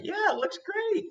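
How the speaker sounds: high, slow mock-cheery gush; fake praise. Giving a colleague useless praise, high slow fake cheer. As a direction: gush the empty compliment way up high. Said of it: "you don't just say".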